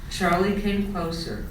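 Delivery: usual speaking voice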